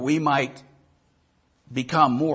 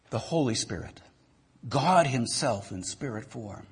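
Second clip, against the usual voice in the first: closing prayer. Acted. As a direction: pray humbly over the congregation. Pastor leads closing prayer.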